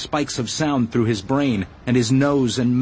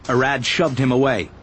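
ordinary delivery, nothing marked

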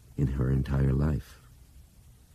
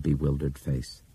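usual speaking voice

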